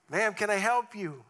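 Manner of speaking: a biker offering help to a stranded woman, lightly voiced; polite stranger. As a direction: call out politely through the window. Biker voiced in retold story.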